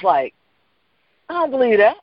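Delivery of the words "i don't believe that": high, shocked protest from the partner; much higher, shocked and upset partner; upset disbelief. Retold or imitated. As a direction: push back, hurt it came by phone. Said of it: "she literally was, like, upset".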